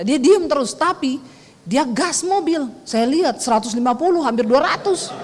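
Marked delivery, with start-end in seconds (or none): none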